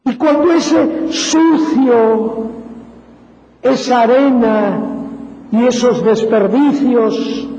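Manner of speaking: ordinary delivery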